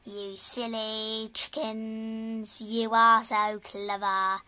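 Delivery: plain reading voice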